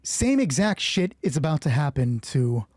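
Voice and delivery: normal talking voice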